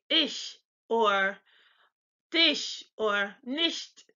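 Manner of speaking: ish, dish, nicht with ch blown like a whistle; soft German CH. Shown as a demonstration. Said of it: "it's very soft".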